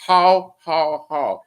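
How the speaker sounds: put-on German-accented villain, separated syllables; mock German bad-guy laugh; Hans Gruber. Riffing on Die Hard, hammed-up German accent. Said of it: "Germans make the best bad guys".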